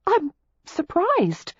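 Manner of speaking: very high, slow, halting surprise; startled. A narrator's reading. Daisy answering an unexpected suitor, startled. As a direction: squeeze it out, taken aback.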